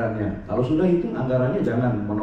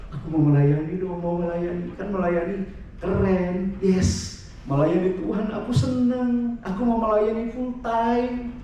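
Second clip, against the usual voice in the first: high, drawn-out dreamy enthusiasm; acted eagerness, pitched up; eager volunteer; 5 semitones higher, slower than usual. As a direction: gush like a keen new recruit. Acts eager would-be church worker.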